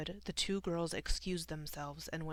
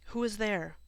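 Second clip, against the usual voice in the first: raised, higher call through a door; 4 semitones higher, 6 dB louder than usual. A narrator's reading. Girl asks who is at the front door, calling out. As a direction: call out to whoever is outside. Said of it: "called Esperanza through the door".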